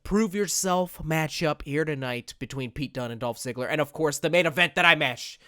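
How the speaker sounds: usual speaking voice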